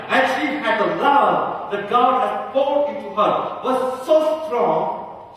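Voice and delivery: loud, slow, emphatic sermon climax; high, ringing preacher's voice; impassioned preaching. Real, not acted. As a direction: proclaim it with full conviction, stretching key words. Preaches at full force.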